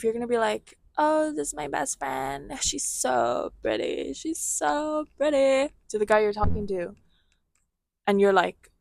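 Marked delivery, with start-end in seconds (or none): 1.0-5.7 high, drawn-out gushing over a friend; gushing mock introduction. Showing off a best friend to her guy, mock gushing. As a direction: play up the syrupy praise, slow and high.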